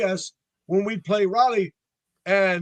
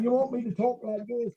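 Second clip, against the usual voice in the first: higher-pitched mock British accent; 6 semitones higher than usual. Musician offering a label man his Britishness, mock British accent. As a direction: put on a jokey, exaggerated British accent. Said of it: "where's your British accent?".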